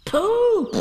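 very high, loud piglet call; crying out. A narrator's reading. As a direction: call out in a piercing little squeal. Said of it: "he cried".

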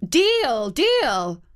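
quick eager agreement; eager calling out. A narrator's reading. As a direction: call it out eagerly. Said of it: "called out Queen Mab".

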